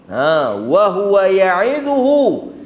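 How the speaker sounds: slow chanted verse; Quran recitation. Acted. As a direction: draw the Arabic out slowly. (bacaan ayat perlahan)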